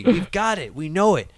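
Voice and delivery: ordinary talk, nothing marked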